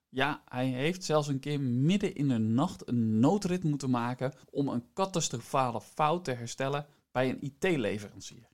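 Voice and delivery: ordinary talk, nothing marked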